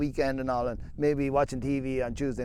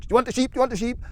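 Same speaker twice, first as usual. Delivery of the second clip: high, eager repeated question; eager dog voice; 9 semitones higher, much faster than usual. Retold or imitated. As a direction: push it like an overeager helper. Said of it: "he'll give you too much".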